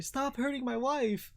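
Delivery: high wail at ordinary volume; husband's thin wail. Acted. Husband smacking an orc, high plaintive wail. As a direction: wail it high without shouting. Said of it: "he's like".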